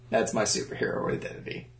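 whispered. Real, not acted. Trails off into a whisper.

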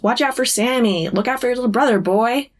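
a hint of a father giving orders; John. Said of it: "you can still hear John's voice in your head".

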